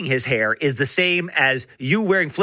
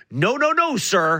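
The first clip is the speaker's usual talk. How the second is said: mock scolding; 4 semitones higher, slower than usual. Mock scolding an imagined traveller.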